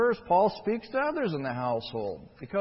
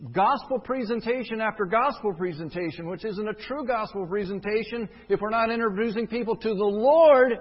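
rising rebuke; 4 semitones higher, 4 dB louder than usual. Acted. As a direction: build the protest to a hammered finish. Preacher rebukes weak gospel presentations.

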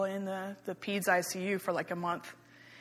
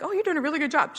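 higher, singsong praise, imitating herself; encouraging compliment; 8 semitones higher, faster than usual. Instructor complimenting an older beginner at aerobics, encouraging. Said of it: "I would try to compliment her".

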